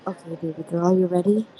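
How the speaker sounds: low imitation of an ASMR boyfriend; boyfriend ASMR dude. Describing a boyfriend ASMR video to a friend, low mock-boyfriend voice. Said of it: "it's just a dude that's like".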